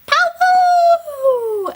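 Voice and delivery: very high, loud trumpeting call; elephant trumpet. Pretend. Playing Ellie Elephant for little ones, loud high trumpet. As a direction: blast it like an elephant's trunk. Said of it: "what does Ellie Elephant say".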